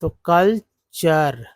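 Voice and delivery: कल्चर very slow, कल then चर; teacher models कल्चर slowly; slow pronunciation demo. Shown as a demonstration. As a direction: stretch कल्चर out for learners. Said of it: "सीयूएल को कल बोलते हैं".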